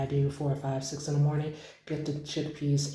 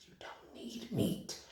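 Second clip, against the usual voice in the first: whispered repeat; 7 semitones higher, 12 dB quieter, slower than usual. Shown as a demonstration. Vlogger whispering a tip to viewers, hushed. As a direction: whisper it, higher and slower. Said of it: "repeated as whisper".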